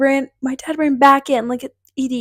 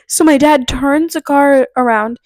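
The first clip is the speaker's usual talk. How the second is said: ordinary talk, nothing marked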